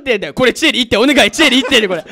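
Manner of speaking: loud, high, laughing protest; laughing excited shouting. Real, not acted. Laughing at his friend on the radio call, loud excited shouts. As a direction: yell it out through laughter.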